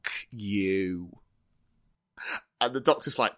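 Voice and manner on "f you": low, quiet and drawn out; deadpan swear. Acted. The Doctor answering after a long beat of silence, quiet deadpan swear. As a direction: let the curse drop softly after silence. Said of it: "big beat of silence and she'd just go".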